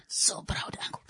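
hushed, whispered aside. Real, not acted. An uncle slips in a side note, whispered. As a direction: drop to a whisper, as if confiding.

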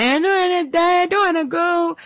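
high, begging voice; high pleading. Retold or imitated. Patient begging God to let him return, high pleading. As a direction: plead high like a scared soul.

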